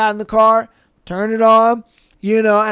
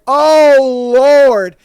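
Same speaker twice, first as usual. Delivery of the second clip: loud, drawn-out, higher exclamation; acted-out dismay; 3 semitones higher, 8 dB louder than usual. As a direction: wail it as trouble dawns, playing it up. Retold dismay at the revelation.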